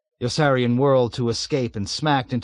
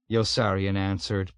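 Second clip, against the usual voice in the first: plain reading voice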